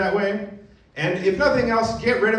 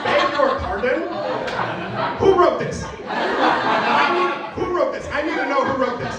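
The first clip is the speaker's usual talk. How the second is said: mock outrage; 9 semitones higher than usual. Real, not acted. Incredulous outrage at documentary.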